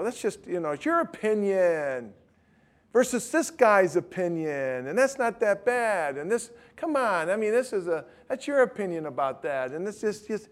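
mock the shrug of relativists; dismissive mocking quote. Preacher mocking those who call theology mere opinion, dismissive and high.

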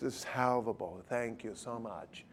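posh-intellectual British put-on, said softly; soft, tongue-in-cheek British accent; mock British accent. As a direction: slip into a playful British accent, quietly. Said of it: "I go in the British accent".

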